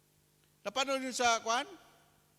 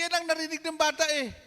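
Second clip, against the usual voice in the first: ordinary delivery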